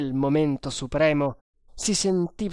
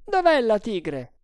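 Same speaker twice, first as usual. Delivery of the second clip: urgent question; 7 semitones higher, 4 dB louder than usual. A narrator's reading. Guard captain questioning sentries.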